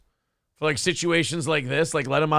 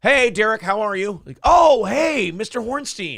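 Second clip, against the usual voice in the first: louder, higher, friendly greeting; chummy businessman; 5 semitones higher, 4 dB louder than usual. Retold or imitated. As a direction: sweet-talk an old acquaintance. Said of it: "the guy goes".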